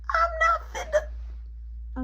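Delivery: very high, slow squeal of refusal; mock-whiny squeal; high-pitched protest. Voicing indignant refusal in story.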